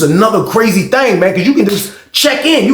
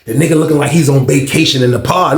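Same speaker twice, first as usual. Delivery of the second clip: ordinary talk, nothing marked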